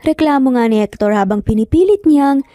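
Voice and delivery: plain reading voice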